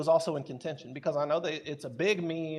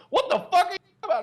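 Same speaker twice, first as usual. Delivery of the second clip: high, loud, fast mock outburst; shocked outcry; 7 dB louder, faster than usual. Friends reacting to a crude joke, high loud disbelief. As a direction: blurt it out in disbelief. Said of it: "everybody was like".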